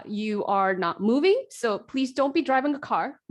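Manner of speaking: usual speaking voice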